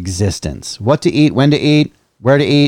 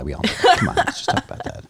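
whisper; 9 semitones higher, 8 dB quieter, faster than usual. Pretend. Unvoiced aside after love joke.

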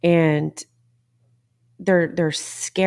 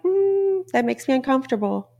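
high squeaky "Mm" then quick mock-whiny reluctance; 7 semitones higher than usual. Mocking people who resist change with a whiny, reluctant voice. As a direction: sing the hum up high, then hurry the complaint. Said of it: "it's like".

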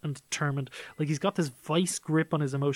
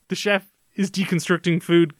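normal talking voice

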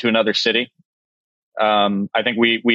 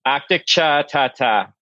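put-on slow, thick Boston masshole accent with the r's dropped; 3 semitones higher, slower than usual. Chef jokes about a dish name in Boston masshole accent. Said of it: "in a masshole accent".

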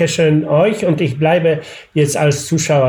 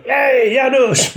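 mock street call; 10 semitones higher than usual. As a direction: hail him like a delivery rider passing by. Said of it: "plötzlich einer".

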